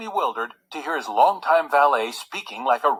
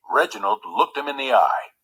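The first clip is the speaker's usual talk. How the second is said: ordinary delivery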